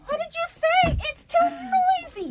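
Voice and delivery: mother yelling over the noisy park; yelled. A narrator's reading. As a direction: yell as if over a crowd. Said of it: "yelled Eva's mother".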